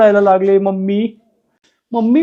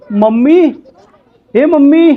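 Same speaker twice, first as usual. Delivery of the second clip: louder, higher call across the house; 6 semitones higher, 5 dB louder, slower than usual. Acted. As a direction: shout for mom who is out of sight. Child calling for mom again. (आईला मोठ्याने हाक)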